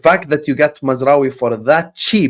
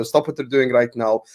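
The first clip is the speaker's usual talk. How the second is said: ordinary talk, nothing marked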